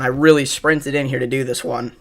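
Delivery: ordinary talk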